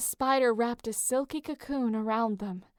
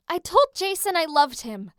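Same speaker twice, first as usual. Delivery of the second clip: higher, louder sudden confession; blurted out; 6 semitones higher, 6 dB louder than usual. A narrator's reading. Piper blurts her secret to Leo, flustered outburst. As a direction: let it burst out. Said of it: "Piper blurted out".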